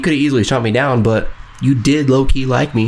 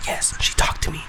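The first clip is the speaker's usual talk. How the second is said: secret whisper of triumph; whispered glee; 8 dB quieter than usual. Retold or imitated. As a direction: whisper it like a little victory. Retold secret inner joy.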